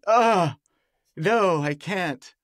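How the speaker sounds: high groan then a very slow "no, I can't"; hammed-up hurt refusal; mock betrayed disgust. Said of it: "like you've been cheated on".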